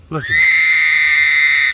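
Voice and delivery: loud, slow, drawn-out call; excited call. Real, not acted. Man watching birds calls out loudly. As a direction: call out, thrilled at the sight.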